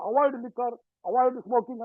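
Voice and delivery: loud, firm doctor's order; forceful, somewhat aggressive. Retold or imitated. Doctor ordering smokers and drinkers to quit, loud and forceful. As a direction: re-enact a zealous young doctor laying down the law. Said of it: "కొంచెం గట్టిగా మాట్లాడేవాడిని".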